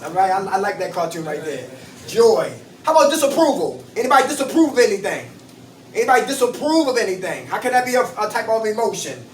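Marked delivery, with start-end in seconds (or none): 4.0-5.3 rattled off fast; quick question. Real, not acted. Repeats the question to the group.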